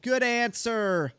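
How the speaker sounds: game show host. Pretend. Game-show style cheer.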